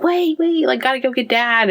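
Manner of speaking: high, hurried call to wait; urgent call. Retold or imitated. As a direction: stop everyone, go fetch Dad. Staff urgently calling for Dad.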